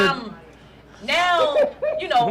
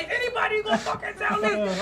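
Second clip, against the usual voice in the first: higher, laughing mock of a heckler; heckling lady; 11 semitones higher than usual. Audience lady calls out the comic again, laughing. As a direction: toss the jab out through laughter. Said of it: "the lady was like".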